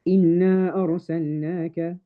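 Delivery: quick recitation, the alif of إِنَّا kept short; recited without mad munfasil. Shown as a demonstration. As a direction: say إِنَّا أَرْسَلْنَاكَ straight through with only two counts on the alif. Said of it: "I would say".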